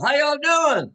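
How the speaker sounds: high, loud hammed-up greeting; big loud howdy; loud Southern hello. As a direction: throw it out like a showman.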